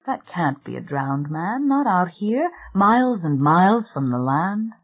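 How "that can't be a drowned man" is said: ordinary delivery, nothing marked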